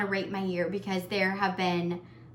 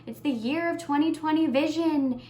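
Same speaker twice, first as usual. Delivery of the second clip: higher, hopeful mock slogan voice; quoting everyone's hype; 7 semitones higher than usual. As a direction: chant it like a cheerful new-year slogan, pitched up. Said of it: "everybody was like".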